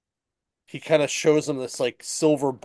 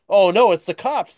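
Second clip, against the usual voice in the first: higher and louder, a hammed-up panicked gasp; mock alarm; 6 semitones higher, 6 dB louder than usual. Elf warning Santa the cops are coming, mock panic. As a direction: play the sudden alarm, lightly mocking.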